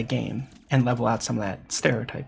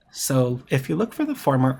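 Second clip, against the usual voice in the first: ordinary talk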